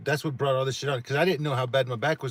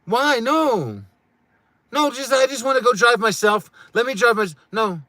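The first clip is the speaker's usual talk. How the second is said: much higher and much louder, near-shriek protest; yelling in disbelief; 11 semitones higher, 12 dB louder, slower than usual. Real, not acted. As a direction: shout it out like you've had enough. Peak of frustrated outburst.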